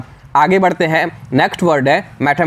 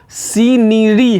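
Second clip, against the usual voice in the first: Scenery stretched very slowly and higher, सी-नि-री; slow sounded out; 6 semitones higher, much slower than usual. Shown as a demonstration. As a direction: draw out scenery letter by letter. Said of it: "आप और ध्यान से देखो".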